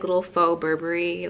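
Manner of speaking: usual speaking voice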